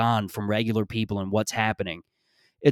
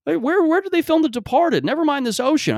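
high, chirpy clueless tourist voice; much higher, excited tourist voice; naive tourist. Retold or imitated. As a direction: play a wide-eyed Midwestern tourist gushing. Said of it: "people from Iowa and people from Saskatchewan".

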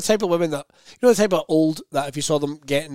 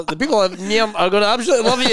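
higher-pitched impression of a Nairn councillor; strong Nairn accent; 6 semitones higher, 3 dB louder, faster than usual. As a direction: imitate his Nairn accent, leaning on the town's name. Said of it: "that's the way he would say it".

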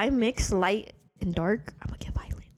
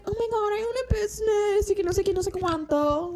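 much higher, showy bragging voice; mocking braggart; 11 semitones higher than usual. Mocking the club guy flexing about his business, high and showy. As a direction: mimic a show-off.

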